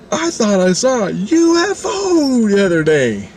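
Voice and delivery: slow, high, drawn-out call for all to hear; theatrical announcement. Retold or imitated. Baiting the two strangers to react, theatrical announcement. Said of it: "and then I scream out".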